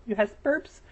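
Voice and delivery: put-on high little-kid squeak; childish troll voice. Gamer taunting the girl who killed him, childish. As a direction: squeak the question like a small child. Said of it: "I put on this little childish voice".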